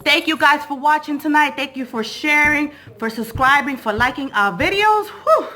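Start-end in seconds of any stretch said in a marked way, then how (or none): 5.1-5.5 high exhale whew after dancing; puffing relief; breathless whew. Real, not acted. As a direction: blow out hard after a workout. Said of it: "I'm out of breath".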